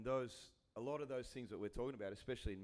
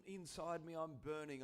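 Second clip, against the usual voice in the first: ordinary delivery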